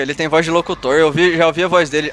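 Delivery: ordinary talk, nothing marked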